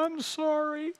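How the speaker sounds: put-on high, small child's voice; little daughter's sorry. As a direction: voice the little girl near tears. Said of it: "I see her lips begin to quiver".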